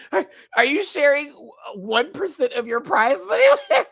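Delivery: high, loud, breaking into laughs; teasing through laughter. Real, not acted. Host teasing Viv about prize money, laughing. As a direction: barely get the question out for laughing.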